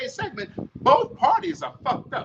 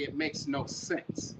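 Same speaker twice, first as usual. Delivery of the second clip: slow, hushed whisper on the repeat; 7 semitones lower, 11 dB quieter, slower than usual. Shown as a demonstration. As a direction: let the point sink in with a slow whisper. Repeats the point, drops to whisper.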